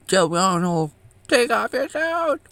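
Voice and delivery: squeaky mock monk barking an order; monk's demand. Monk ordering Joe Arnold to take off his suit, high mock voice.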